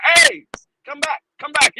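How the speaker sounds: high, urgent retold call; high mock holler; calling after someone. Calls after departing recruiter in story.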